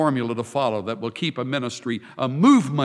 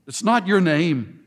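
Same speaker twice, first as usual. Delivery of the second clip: ordinary talk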